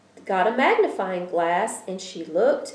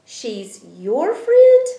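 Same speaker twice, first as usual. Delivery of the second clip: put-on amazed child; 6 semitones higher, 9 dB louder, much slower than usual. A narrator's reading.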